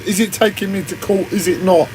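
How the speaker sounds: usual speaking voice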